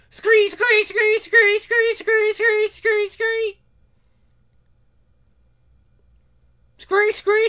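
very high monotone screech; piercing, evenly repeated screeches; creature screeching. Acted. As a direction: screech repeatedly like attacking bats. Imitated screech of attacking creatures.

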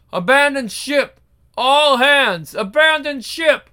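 loud shipboard alarm call; shouting abandon ship. Acted. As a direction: bellow the order over the chaos. Alarm on sinking carrier.

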